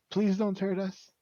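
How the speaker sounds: high, loud frightened plea; screaming, crying child. Acted. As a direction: wail it like a scared kid begging. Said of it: "Kid just screams again".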